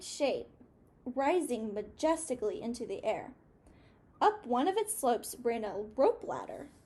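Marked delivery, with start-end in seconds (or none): none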